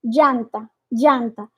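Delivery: "Janta" with a lighter dj sound; softened j. Shown as a demonstration. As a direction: ease off the j in "Janta". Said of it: "diminuir um pouquinho esse J".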